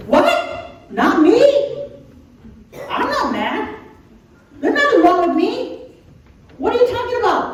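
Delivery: loud, high, indignant protest; hammed-up shocked denial, pitch shooting up; defensive. Someone accused of being upset protests, defensive. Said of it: "you're defensive".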